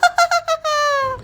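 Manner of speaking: high, drawn-out evil cackle; witch's cackle. Acted. Witch gloats. (चुड़ैल की ऊँची डरावनी हँसी)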